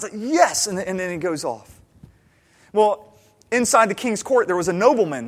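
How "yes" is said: high, loud burst of delight; thrilled exclamation, pitched way up; excited. Retold or imitated. Thrilled farmer reacting to the king's gift, excited. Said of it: "He's so excited".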